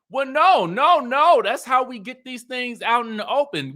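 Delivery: high, loud repeated protest; imitated insistence. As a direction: mimic her emphatic objection. Imitating Stormy insisting on talking.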